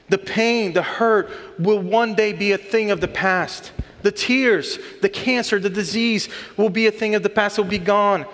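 preacher swelling toward the promise; rising sermon fervor. Acted. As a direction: lift the congregation with mounting hope.

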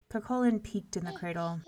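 plain reading voice

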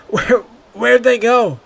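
high, slow, strained question; choked. A narrator's reading. Boy begs the guards after his sprint, choked and slow. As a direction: squeeze the words out, out of breath from the sprint. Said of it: "the boy choked".

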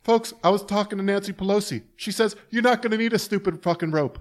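high, put-on stump-speech voice; folksy politician impression. Politician telling the folks about Nancy Pelosi, mock folksy.

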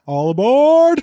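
high loud all aboard call; train conductor call. Pretend. Train joke punchline.

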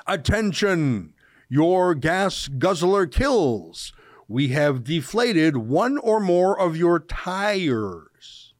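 slow, deliberate read-aloud, each word set apart; slow reading of a flyer. Retold or imitated. Host reading the activists' flyer to viewers, slow and deliberate. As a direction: read the activists' note out slowly, mocking it. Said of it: "I'll read it".

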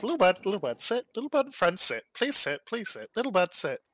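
put-on high, eager old crone; old tabaxi voice. Old tabaxi urging the little bird and friend to sit, high and eager. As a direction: coax them to sit like a dotty grandmother.

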